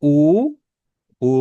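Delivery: উ rounded as the German ü vowel; u umlaut sound. Shown as a demonstration. Teacher demonstrating the u umlaut, clearly. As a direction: show the ü sound with উ. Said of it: "উ umlaut-এর উচ্চারণ হয় হচ্ছে উ".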